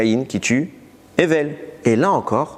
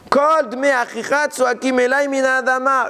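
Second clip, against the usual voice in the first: reading the verse; 12 semitones higher than usual. Acted. Chanting the verse.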